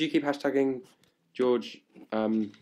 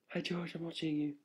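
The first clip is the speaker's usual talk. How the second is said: creepy mock voice; 7 semitones higher, 9 dB quieter than usual. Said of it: "I was like".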